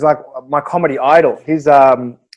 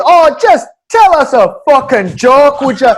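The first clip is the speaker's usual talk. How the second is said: high, drawn-out mock heckle; heckler's yell; 7 semitones higher, 4 dB louder, slower than usual. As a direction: imitate an impatient heckler shouting at the stage. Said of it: "they all started yelling and shouting".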